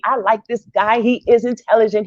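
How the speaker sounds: normal talking voice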